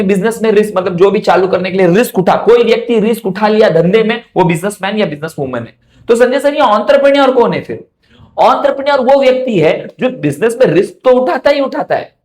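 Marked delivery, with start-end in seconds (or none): 6.1-7.8 imagined student asking. Pretend. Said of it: "student voice asking teacher".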